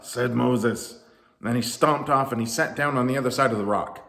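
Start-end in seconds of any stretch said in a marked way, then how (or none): none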